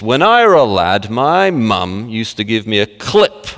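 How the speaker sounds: slow, jokey Yorkshire imitation; Yorkshire accent. Old-timer recalling mum's clip round the ear, Yorkshire accent. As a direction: relish each word in mock Yorkshire. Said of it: "and it's a Yorkshire accent".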